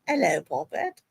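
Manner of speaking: a hint of a British granny; lightly put-on old-lady British greeting; mock British accent. American friend attempting British accent, lightly mock. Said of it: "when you guys try and do a British accent".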